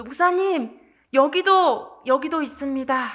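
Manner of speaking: retold cry for help; urgent calling out. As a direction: call the pastor over urgently. Church member calls pastor to crash victim.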